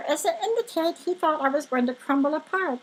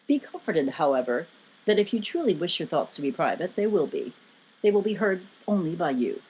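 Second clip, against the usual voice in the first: low, measured, reassuring giant; authoritative giant; 9 semitones lower than usual. A narrator's reading. Giant reassuring his guest about privacy, deep and authoritative. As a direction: comfort them with deep authority. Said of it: "spoke this with a very authoritative tone".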